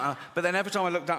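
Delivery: ordinary talk, nothing marked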